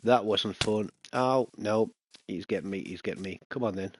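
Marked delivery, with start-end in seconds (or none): none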